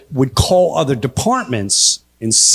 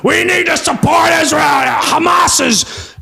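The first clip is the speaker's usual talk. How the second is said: mock shouting; 11 semitones higher than usual. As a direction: shout it high, dragging the last words. Mocks pro-war supporters' line.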